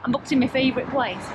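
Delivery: usual speaking voice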